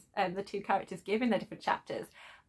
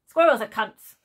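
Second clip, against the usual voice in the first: loud, blunt talking dog; brash dog voice; 11 dB louder than usual. Acted. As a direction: bark it out like a brash, foul-mouthed dog. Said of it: "his very brash, straight-talking dog voice".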